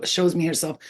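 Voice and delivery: ordinary talk, nothing marked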